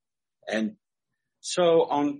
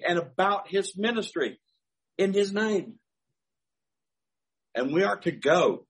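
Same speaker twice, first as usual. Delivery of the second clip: preaching; 5 semitones higher than usual. Acted. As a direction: proclaim it to the congregation. Preaching the application.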